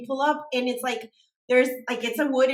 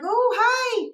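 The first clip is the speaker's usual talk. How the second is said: high, louder surprised greeting; bright surprised hi; 13 semitones higher, 4 dB louder than usual. Retold or imitated. Meeting her new roommate, high surprised hello. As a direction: greet a stranger with sudden delight. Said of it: "And I was like".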